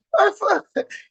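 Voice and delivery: real squealing laugh, very high; delighted high-pitched laugh. Real, not acted. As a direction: burst into a high giggle. Laughing after reading the proverb.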